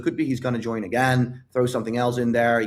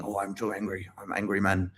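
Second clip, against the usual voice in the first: low, much softer grumbling; hammed-up brooding, kept under the breath; mock angry sulk; 4 semitones lower, 7 dB quieter than usual. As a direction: imitate a sore loser stewing quietly. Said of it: "you were angry".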